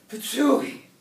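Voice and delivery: high, loud burst; spitting sound word. A narrator's reading. Old chihuahua pops out beans, high loud spat-out word. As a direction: spit the word out suddenly.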